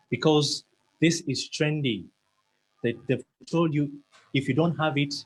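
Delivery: ordinary talk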